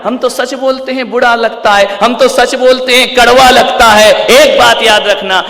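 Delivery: high declamation building to loud; impassioned preaching. Real, not acted. Preacher citing people's excuse about bitter truth, impassioned preaching. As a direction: rise insistently into a loud admonition.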